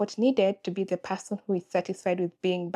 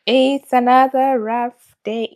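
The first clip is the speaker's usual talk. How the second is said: slow, louder, heavy "It's another rough day"; dragged-out, louder "It's another rough day"; weary lament; 3 semitones higher, 9 dB louder, slower than usual. Real, not acted. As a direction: drag the words out, worn down. Restates after the sigh.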